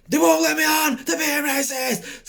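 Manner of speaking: high shouted complaint, slowing at end; shouting to auntie about the staff; calling out. Retold or imitated. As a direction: yell it down the walkway. Yelling to auntie down walkway.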